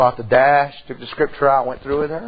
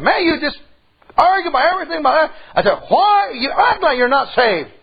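high-pitched mad outburst at his wife; fed up, snapping back with pitch way up; husband venting his anger mid-argument; angry retort; 11 semitones higher than usual. Retold or imitated. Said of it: "I was angry".

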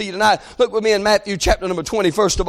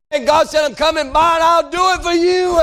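exultant preaching; 7 semitones higher than usual. Acted. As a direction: preach it with rising fervor. Preaches at full force.

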